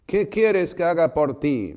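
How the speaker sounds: loud, high, booming question; strong voice. Retold or imitated. Jesus questioning the blind man, in a strong loud voice. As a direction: project it forcefully so it goes right in. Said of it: "van a tener un habla fuerte".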